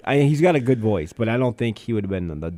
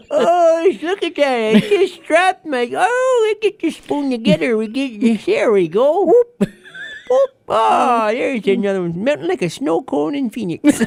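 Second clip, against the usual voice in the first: put-on high old lady falsetto; Mrs. Doubtfire; 5 dB louder than usual. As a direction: squeal like a frazzled old nanny. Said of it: "Missus Doubtfire".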